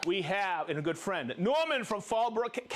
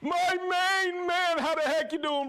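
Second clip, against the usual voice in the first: hype shoutout; 8 semitones higher, 3 dB louder, slower than usual. Real, not acted. Hyping a viewer shoutout.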